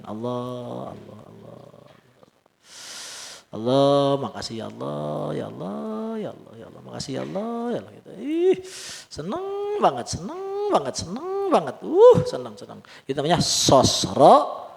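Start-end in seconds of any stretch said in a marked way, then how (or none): 0.0-7.9 lirih, hampir berbisik, berterima kasih pada Allah; bisik syukur. Acted. Acting a grateful servant's whispered prayer. 8.2-12.8 jauh lebih tinggi, girang sekali; kegirangan. Acted. Acting a servant overjoyed.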